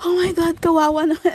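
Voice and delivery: high-pitched, dismayed exclamation; shocked pity. Retold or imitated. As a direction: relive your horror for the poor chick. Retelling pity for the dead chick. (gulat na naaawa)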